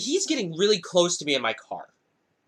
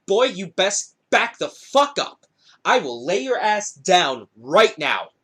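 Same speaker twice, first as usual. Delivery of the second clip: slow, weighted retold threat; acted threat; 3 dB louder, slower than usual. Teller squaring up to the driver, slow acted threat. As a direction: draw the threat out word by word.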